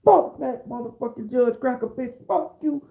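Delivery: high-pitched acted tirade; laying into everybody. Defendant cursing out the whole courtroom, acted rage. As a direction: rattle off curses with the voice way up. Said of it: "I'm laying into everybody in the courtroom".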